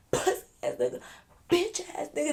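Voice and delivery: put-on spluttering drunk insult; mock spitting while talking. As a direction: imitate a drunk spraying spit on the words. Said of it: "He, like, spitting all".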